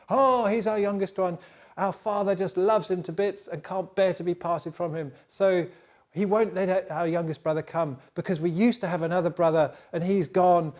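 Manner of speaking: eager brother. Acted. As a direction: pipe up brightly about the family.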